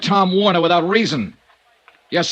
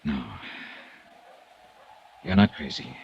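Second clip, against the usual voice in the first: tender reassurance; 8 dB quieter than usual. Acted. Dollar answering the dying Abbott, quiet and tender. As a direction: reassure him as he fades.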